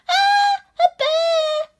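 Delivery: very high, loud frightened scream; high-pitched storybook scream of fright. A narrator's reading. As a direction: shriek it in terror like a little boy. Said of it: "screamed Eddie".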